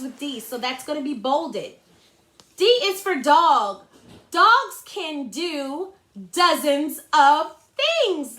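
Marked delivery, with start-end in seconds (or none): 2.6-8.4 read-aloud. Shown as a demonstration. Teacher reading slowly aloud.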